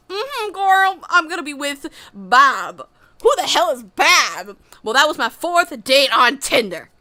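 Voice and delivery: put-on loud, high smug girl talk; sassy girlfriend. Viewer bragging about dates, loud and sassy. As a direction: brag it to your bestie.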